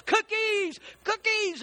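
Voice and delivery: high-pitched call, drawn out; calling to birds. Acted. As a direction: holler it out to summon the birds. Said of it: "called to the birds".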